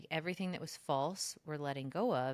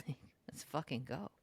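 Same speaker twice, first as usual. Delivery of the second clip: hyped whisper; 4 dB quieter than usual. Retold or imitated. Hyped confident new self.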